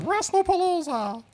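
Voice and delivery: very high, drawn-out cartoon squeak; high-pitched cartoon mascot voice; Mickey Mouse. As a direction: stretch it out in a tiny falsetto mouse voice. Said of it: "Mickey Mouse voice".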